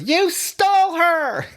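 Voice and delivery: high, slow shrieked accusation; mock scream. Jessica Biel screaming at the kidnapper, high and slow. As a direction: shriek it like a horror heroine. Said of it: "screams at her".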